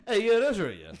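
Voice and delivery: loud, high hammed-up "Hey, yeah, that's right"; caller's catchphrase. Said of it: "And then he goes".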